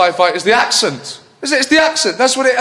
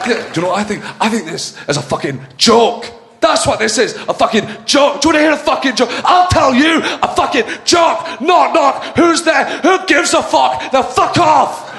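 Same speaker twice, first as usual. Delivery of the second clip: higher, ranting furious shouts; Malcolm Tucker rant; 5 semitones higher than usual. Acted. Capaldi as Doctor Who screaming at everyone, furious rant. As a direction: tear everyone apart in a sweary rage. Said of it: "Walking into the building going".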